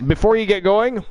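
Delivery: hyped-up ring announcer intro. Acted. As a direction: sell the matchup like a ring announcer. Host re-announces semifinal match.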